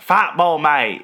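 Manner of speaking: mock British accent. Friend hamming up football talk, mock British accent. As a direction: put on a cheeky British accent. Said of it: "'Cause, like, British accent".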